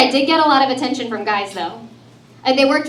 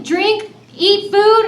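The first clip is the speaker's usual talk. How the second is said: usual speaking voice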